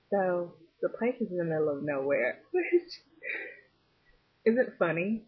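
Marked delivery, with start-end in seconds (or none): 2.5-3.0 high laughing burst; burst of laughter. Real, not acted. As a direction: break out laughing. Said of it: "I don't know why I'm laughing".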